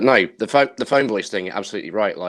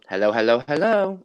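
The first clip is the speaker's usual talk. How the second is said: playful hello rising much higher on the last; 4 semitones higher than usual. Retold or imitated. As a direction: sing it out like a drag host. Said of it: "I have started doing [name]".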